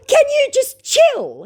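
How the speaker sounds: very high, slow put-on voice; cartoonish scammer voice; mock scammer whine. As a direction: squeak his plea, high and drawn-out. Voicing scammer.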